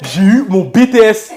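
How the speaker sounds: loud, high, slow, laughing through it; laughing shout. Real, not acted. Student tells his mother he passed, laughing shout.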